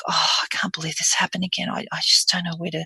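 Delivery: breathy, whispery dismay. Shown as a demonstration. Coach playing an upset patient, breathy. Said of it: "a breathy voice".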